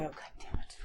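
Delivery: a breathless, whispered curse. Acted. June curses to herself eyes closed, whispered. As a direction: let it slip under your breath.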